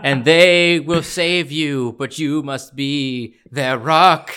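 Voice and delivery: slow, high, loud heavenly voice; angelic voice. Retold or imitated. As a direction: intone it like a heavenly messenger. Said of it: "angelic voice of".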